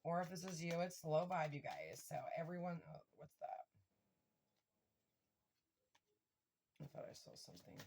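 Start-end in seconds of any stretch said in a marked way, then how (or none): 2.9-7.8 voiceless, very soft aside to self; hushed aside. Real, not acted. Tarot reader distracted by something she thought she saw, hushed aside. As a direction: drop to a near-whisper, talking to yourself.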